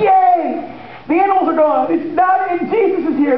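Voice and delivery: put-on high, gleeful child cheer; excited kids. Temple children cheering that the animals are gone, high and gleeful. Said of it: "the kids are like".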